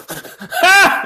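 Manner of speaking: loud, high burst of exaggerated laughter; imitated too-hard laugh; overeager forced laugh. Eager actor at Seth MacFarlane's joke, laughing too hard. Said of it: "I laughed a little too hard".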